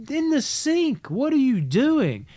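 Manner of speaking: very high, loud, drawn-out mock scolding; retold exasperated scolding. Parent to kid wiping chocolate on paper, exasperated. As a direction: stretch each phrase in exasperated disbelief.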